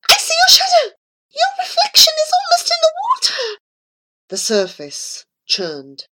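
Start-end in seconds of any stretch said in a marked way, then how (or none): 0.1-3.6 much higher, louder, fast panicked cry; the cat howling in fright at Abu's shadow; frightened howl. A narrator's reading. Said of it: "howled with fright".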